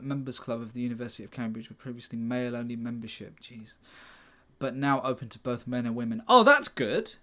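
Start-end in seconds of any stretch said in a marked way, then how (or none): 6.3-7.1 mock approval. Sarcastic reaction to the club's history.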